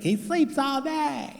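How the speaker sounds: high, slow mocking wife voice. Wife tattling to the psychiatrist, high mock voice. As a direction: squeak it out as the nagging wife. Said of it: "She's like".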